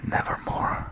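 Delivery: a breathy whispered croak; ghostly voiceless raven; raven's answer. A narrator's reading. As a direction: let the raven breathe its one word. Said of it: "Quoth the raven".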